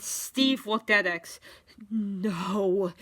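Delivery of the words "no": hushed gasp of disbelief. A narrator's reading. Steve looks at X, gasping lightly. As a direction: breathe it out, mildly stunned. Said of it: "he gasped".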